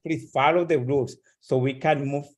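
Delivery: usual speaking voice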